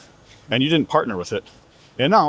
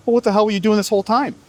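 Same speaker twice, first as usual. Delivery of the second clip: exasperated outburst; 8 semitones higher than usual. Real, not acted.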